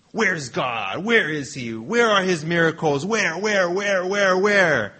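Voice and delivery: mildly hammed-up lament, building on each where; Gideon complaining. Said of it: "here's Gideon complaining".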